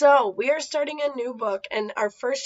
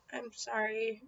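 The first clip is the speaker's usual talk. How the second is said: softer, grudging apology; muttered; 10 dB quieter than usual. Acted. Carrie forced to apologize to Eddie, soft grudging mutter. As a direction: mutter it through clenched teeth. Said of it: "she muttered through clen".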